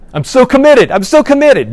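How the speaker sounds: high, loud mock insistence; mocking high vow.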